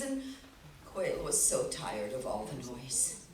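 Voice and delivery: ordinary delivery, nothing marked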